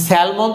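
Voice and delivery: স্যালমন with the L sounded; wrong pronunciation. Shown as a demonstration. Teacher rejects what no native speaker says, wrong pronunciation of স্যালমন. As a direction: say স্যালমন as "sal-mon", clear L. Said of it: "তো একেবারেই না".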